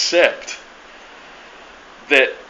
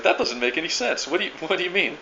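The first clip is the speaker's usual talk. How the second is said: higher-pitched, puzzled retort; mock confusion; 5 semitones higher, 5 dB quieter than usual. Old timer questioning the refusal, mock confused. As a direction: imitate a baffled man, softer and higher. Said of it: "got this confused look on his face".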